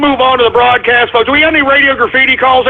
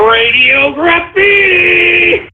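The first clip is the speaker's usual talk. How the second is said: big announcer hype; 8 semitones higher, much slower than usual. Acted. Host hyping his call-in segment.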